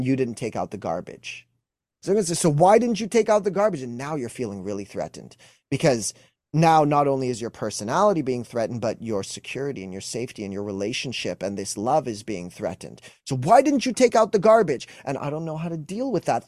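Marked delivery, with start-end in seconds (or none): 2.0-3.8 higher, louder accusing question; mock accusing wife. Acted wife's accusing question. 13.3-14.9 much higher, louder nagging question; mock angry wife. Acted wife's accusing question.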